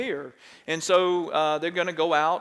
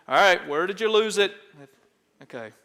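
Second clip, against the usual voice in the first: usual speaking voice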